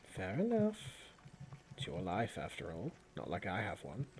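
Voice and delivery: lightly voiced companion, dropping low and soft after the opening; a hint of dry resignation, voice sinking away; resigned companion. Game master voices the companion.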